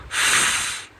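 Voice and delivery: फ as pure hissing air, no vowel; phonics f sound. Shown as a demonstration. Teacher repeating the f sound as escaping air. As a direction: blow out फ like air leaking from a balloon. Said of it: "जैसे कि balloon में से हवा निकल रही हो".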